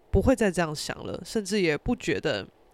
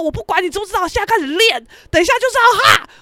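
high, loud, building to a shout; imitated bossy order; 10 semitones higher, 8 dB louder, faster than usual. Friend drilling karaoke singers, loudly insistent. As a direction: command the group, getting louder.

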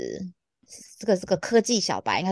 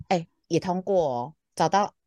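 normal talking voice

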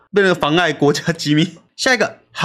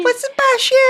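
put-on baby voice. Shown as a demonstration. Joins the baby-voice game.

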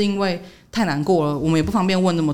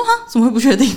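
surprised; 6 semitones higher, 5 dB louder, faster than usual. Retold or imitated. Shocked at unexplained coma.

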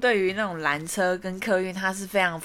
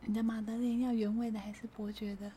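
slightly louder; 14 dB quieter than usual. Retold or imitated. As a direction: still very soft, a bit clearer. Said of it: "我听不到". (稍微大声一点但仍很小声)

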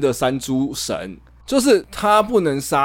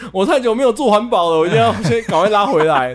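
higher, louder urgent self-talk; panicked inner voice; 6 semitones higher, 3 dB louder, faster than usual. Pretend. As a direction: hurry like he just remembered. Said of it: "突然想到说". (慌张地自言自语)

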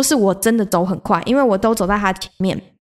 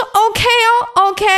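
high, slow, resigned drawn-out OK; 拖长的无奈OK; 12 semitones higher, 3 dB louder, much slower than usual. Retold or imitated. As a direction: sigh it out like giving up, pitched way up. 无奈接受继续爬. (拉长音、高声无奈地说OK)

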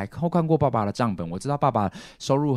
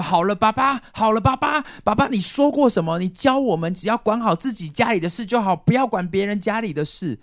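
音调高很多、更响的劝阻声; 像儿子急着拉住爸爸那样劝; 模仿堂弟劝架; 12 semitones higher, 5 dB louder than usual. Retold or imitated. (学堂弟高声劝爸爸)

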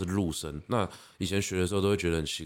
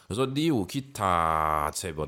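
normal talking voice

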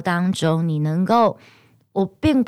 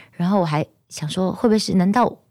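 ordinary delivery

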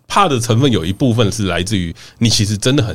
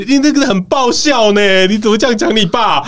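mom; 9 semitones higher than usual. Retold or imitated. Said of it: "我妈跟我说".

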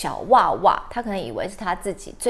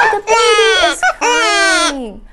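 high, loud wailing voice; mock crying; 10 semitones higher, 11 dB louder, slower than usual. Teacher telling the children the baby cries, mock wailing.

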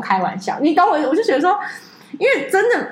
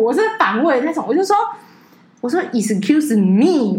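normal talking voice

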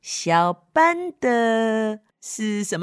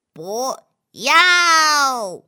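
defiant refusal; 4 semitones higher, 5 dB louder than usual. Acted. Child refusing.

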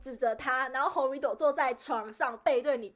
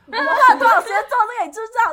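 retelling her own furious yell, much louder and higher, rushed; furious outburst; 10 semitones higher, 14 dB louder, faster than usual. Retold or imitated. Scolding the younger kids who wrecked the project, furious yell. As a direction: blast it at the kids like you've lost it. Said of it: "反正就是超生气".

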